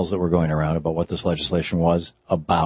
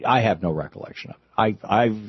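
ordinary talk

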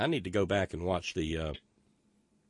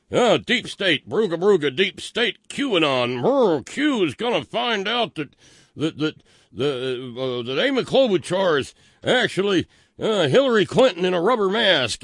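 high, loud, hammed-up conspiracy nut ranting; mocking wingnut voice; 11 semitones higher, 10 dB louder than usual. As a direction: squeal it like a frantic paranoid crank. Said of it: "what passes for the mind of maggots".

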